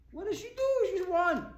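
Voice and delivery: high, loud disbelieving cry; incredulous exclaim. Real, not acted. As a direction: throw it up in disbelief.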